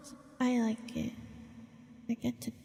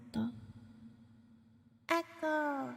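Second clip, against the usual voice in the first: higher, softer word with echo trailing; echo effect test; 3 semitones higher, 8 dB quieter, slower than usual. Shown as a demonstration. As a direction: test the echo, letting it ring out. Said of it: "is the echo working".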